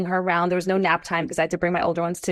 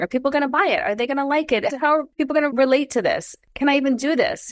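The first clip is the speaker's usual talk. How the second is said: higher-pitched run of worried questions; retold anxious questioning; 7 semitones higher than usual. Founder recalling launch doubts, higher-pitched mild worry. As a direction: voice rising self-doubts about a launch. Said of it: "was terrifying".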